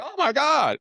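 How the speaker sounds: exaggerated high cry of shock; mock shocked reaction. Acting out the room's reaction.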